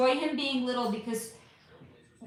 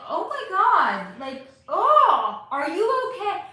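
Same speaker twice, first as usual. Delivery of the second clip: mock worried friend; 6 semitones higher, 7 dB louder, slower than usual. Shown as a demonstration. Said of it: "Like that?".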